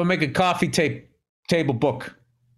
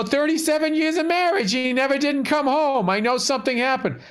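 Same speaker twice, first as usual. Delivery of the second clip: imitating the wife; 8 semitones higher than usual. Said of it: "'cause he's like".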